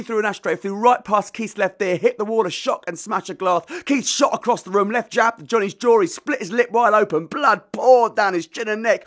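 measured spoken-word reading with acted voices; dramatic poem performance. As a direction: read the poem aloud like a live stage show. Performs his narrative poem.

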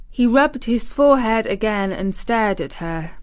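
plain reading voice

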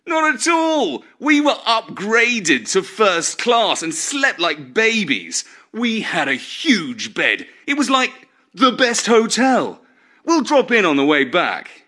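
a lucky brother bragging, pitched well up; smug brother Jeff. Acted. Jeff boasting to Nigel about first class, high smug voice.

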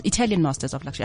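ordinary talk

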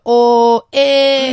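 loud, high exaggerated "oh, eh"; mock Nigerian exclamation. South Africans mocking Nigerians with a loud "oh, eh". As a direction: overdo the Nigerian interjection. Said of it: "we put the".

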